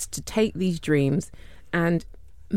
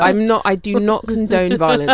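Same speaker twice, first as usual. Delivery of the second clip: mock official disclaimer; 4 semitones higher, 6 dB louder than usual. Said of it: "just say".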